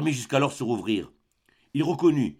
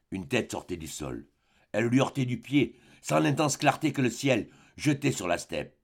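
plain reading voice

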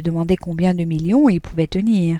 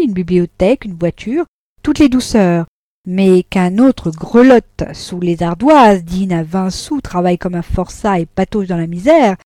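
sarcastic tirade; 6 dB louder than usual. Acted.

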